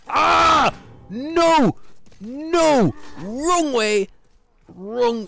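very high, loud shouts; panicked yell. Real, not acted. Streamer panicking at a wrong turn, high yelling. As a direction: scream it as things go wrong.

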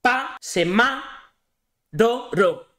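"pa, sema" high and drawn out, then "do, ro", pauses between; stressed and final syllables. Shown as a demonstration. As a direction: pick out "pa, sema" and "do, ro" as separate slow beats. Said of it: "tienen que rimar la tónica y la sílaba final".

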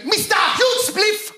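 high, slow, exaggerated name announcement; stretched-out mock-formal name reading; mock newsreader. Mock newsreader announcing silly names.

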